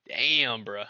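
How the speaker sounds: ordinary talk, nothing marked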